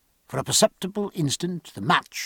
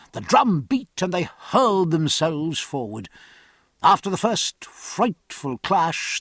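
ordinary delivery

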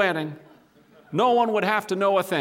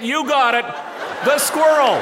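louder and higher, building into a shout; excited shout; 5 semitones higher, 5 dB louder than usual. A narrator's reading. Comic climax of chase.

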